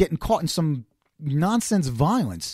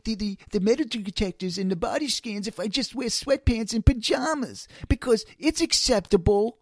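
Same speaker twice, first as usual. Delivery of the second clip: higher, whiny mock traveler; 6 semitones higher than usual. Mocking airport travelers in pajamas, higher mock whine. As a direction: parody a lazy traveler justifying pajamas.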